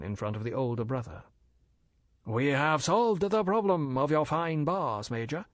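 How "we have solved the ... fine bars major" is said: higher, smooth merchant's voice; polite and bland, a dealer explaining; bland Chinese merchant. Acted. Older Fu brother lecturing the major, smooth and polite.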